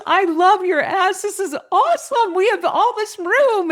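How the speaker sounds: high, squealing delight, hammed up; excited seatmate. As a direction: gush in a very high voice as the thrilled woman. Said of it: "she was so excited".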